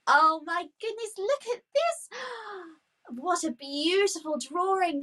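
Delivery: high gasp of amazement; wowed praising a drawing. Real, not acted. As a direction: marvel at the drawing.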